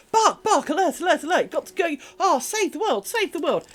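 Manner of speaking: hammed-up panic to trick her brother off the spot; dog raising alarm. Amy the dog faking an alarm to her brother, high hammed-up panic. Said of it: "Amy occasionally winds her brother up with the".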